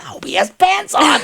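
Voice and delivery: high shouted joke; joking shout. Retold or imitated. Relative's joke at funeral.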